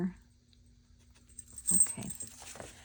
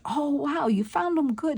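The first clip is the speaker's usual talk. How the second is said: 1 mock surprised clerk; 7 semitones higher, 5 dB louder than usual. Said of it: "and he says".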